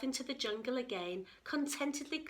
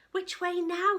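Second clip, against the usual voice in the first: excited Katie; 9 semitones higher, 9 dB louder than usual. A narrator's reading. Katie's eager question.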